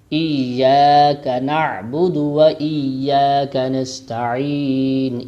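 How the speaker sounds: slow measured recitation with short vowels; tajweed recitation. Shown as a demonstration. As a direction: chant the verse slowly. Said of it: "recitation demo".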